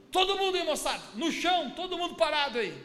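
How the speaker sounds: high, loud raid order, acted out; police shout. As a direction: yell a command like police raiding a crowd. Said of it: "eles gritaram assim". (grito de policial dando ordem)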